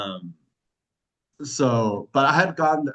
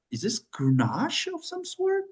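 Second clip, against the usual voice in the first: slow imitated question rising very high; puzzled guess. Taster guessing a blinded wine, puzzled questioning. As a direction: guess slowly, sliding way up in doubt.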